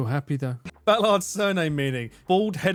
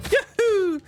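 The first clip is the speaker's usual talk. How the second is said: a light Mario yahoo; high Mario cheer; 4 dB louder than usual. Retold or imitated. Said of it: "sound like Mario".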